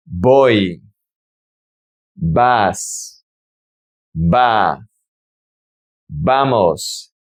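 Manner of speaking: VOY, VAS, VA, VAMOS each said alone with a falling tone; pronunciation model. Shown as a demonstration. Teacher drilling the verb to go for learners, pronunciation model. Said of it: "Let's practice the pronunciation".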